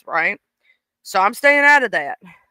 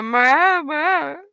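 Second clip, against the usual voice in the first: higher, whiny dog imitation of mama; dog saying mama; 5 semitones higher than usual. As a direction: copy the dog's whine. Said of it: "She go".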